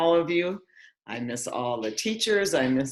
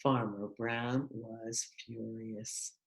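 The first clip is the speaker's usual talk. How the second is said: grim; 4 semitones lower, 10 dB quieter, slower than usual. A narrator's reading. Narrator slows on furious.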